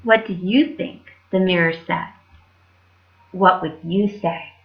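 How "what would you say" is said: ordinary delivery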